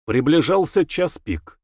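plain reading voice

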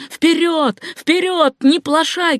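high, ringing cries to press on; high-pitched rallying yell; urging shout. A narrator's reading. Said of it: "кричал Симпсон".

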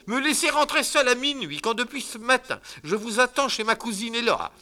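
high loud aggrieved lady; reproachful wife. Acted. Wife reproaching her husband, aggrieved. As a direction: scold him with injured pride.

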